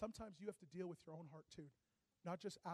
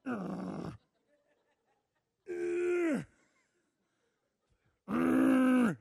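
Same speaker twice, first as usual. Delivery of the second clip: loud, angry grunts, rising higher; 6 semitones higher, 18 dB louder, much slower than usual. Retold or imitated. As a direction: grunt it out like you're furiously writing a check. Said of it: "You ever had angry writing?".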